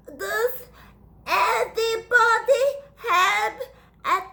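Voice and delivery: high, tearful little flea voice; whiny sick flea. Acted. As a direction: plead like a sniffly tiny bug.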